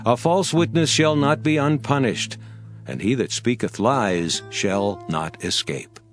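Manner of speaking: ordinary delivery, nothing marked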